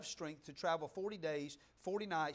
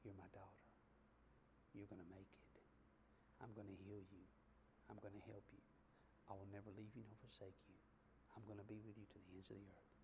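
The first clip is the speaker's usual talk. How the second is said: soft breathy whisper, much lower and softer; 8 semitones lower, 18 dB quieter, faster than usual. Pretend. Preacher voicing God whispering to a hurting daughter. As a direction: lean in and whisper comfort close to the ear. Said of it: "God is right there whispering".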